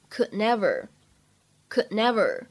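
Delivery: "could never" with the d held, unreleased; "could never" with no burst on the d; incomplete plosion. Shown as a demonstration. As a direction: stop the d of "could" silently, then "never". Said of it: "可以有不完全爆破的处理".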